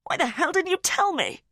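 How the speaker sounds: outraged shout down the phone; furious demand. Acted. Father furious over the phone.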